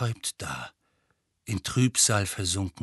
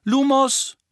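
higher, louder incantation; spell call; 14 semitones higher, 7 dB louder than usual. Acted. Witch casts a light spell.

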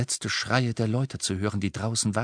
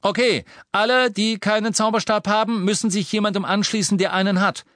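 high, loud rallying orders; commanding; 12 semitones higher, 6 dB louder than usual. Acted. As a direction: lead them out fast. Harry commands crowd.

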